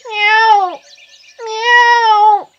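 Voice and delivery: high, plaintive mewing; sad cat meow. A narrator's reading. Transformed sister answering her brother, sad meow. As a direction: mew mournfully like a cat. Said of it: "said the cat sadly".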